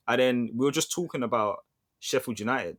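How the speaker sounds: ordinary delivery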